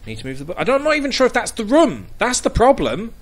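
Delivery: normal talking voice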